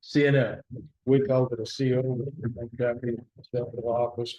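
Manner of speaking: normal talking voice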